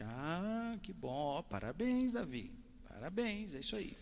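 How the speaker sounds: high, bright-pitched teacher's congratulations; delighted praise. Retold or imitated. Teacher congratulating Davi's mother, delighted and high. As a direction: gush over the boy's good grade.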